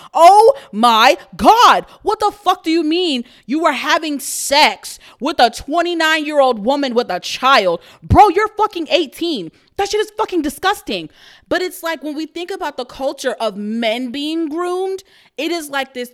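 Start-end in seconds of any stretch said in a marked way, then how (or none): 0.1-11.1 loud, disgusted rant; outraged, appalled yelling. Real, not acted. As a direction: blow up in disgust at the news.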